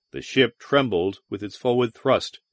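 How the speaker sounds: usual speaking voice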